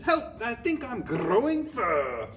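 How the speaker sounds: high, slow mock panic; hammed-up high cry for help; boy's scream. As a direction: wail it like an alarmed schoolboy. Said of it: "A boy who's standing next to her screams".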